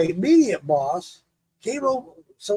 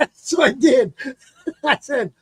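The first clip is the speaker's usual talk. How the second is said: laughing; 7 semitones higher than usual. Real, not acted. Laughing while telling.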